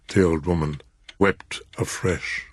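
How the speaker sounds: plain reading voice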